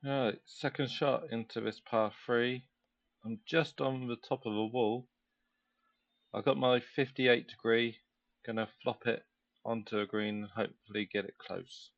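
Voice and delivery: quiet voice like TV golf coverage; golf commentator. Acted. Hushed play-by-play of own shot.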